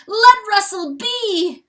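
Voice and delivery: desperate cry. A narrator's reading. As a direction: cry out to defend his friend. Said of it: "yelps Chanticleer".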